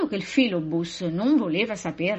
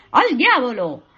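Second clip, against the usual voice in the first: loud, raised-pitch kicker's yell; hearty, high shout; playful shout; 5 semitones higher, 8 dB louder than usual. A narrator's reading. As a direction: yell it with each kick.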